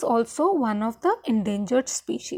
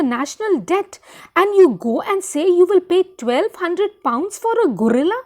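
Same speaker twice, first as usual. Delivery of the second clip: angry disbelief; 4 semitones higher, 5 dB louder than usual. Acted. Wife furious at her husband over the gorilla's price, loud and outraged. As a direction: hammer each word in disbelief. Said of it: "Jackie gets very angry with her husband".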